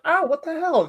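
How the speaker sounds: high startled yelp; imitating a surprised yelp, pitched high; mock outrage. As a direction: react to a slap on the shoulder. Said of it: "I'm like".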